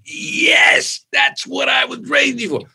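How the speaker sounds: dad's high delighted shout; excited shout. Retold or imitated. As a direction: cheer it out like a delighted dad. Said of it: "He's like".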